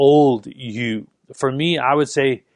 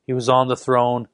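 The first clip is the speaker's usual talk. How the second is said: normal talking voice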